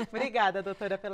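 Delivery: thanks said through a laugh. Real, not acted. Host thanking the doctor after joking about consultations, laughing. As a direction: chuckle while saying thank you.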